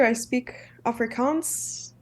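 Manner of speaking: ordinary talk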